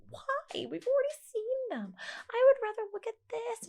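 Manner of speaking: put-on high whiny teen voice; whiny teen self. A daughter recalling her bored teen self, high and whiny. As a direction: whine it high like a bored teenager. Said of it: "I'm like".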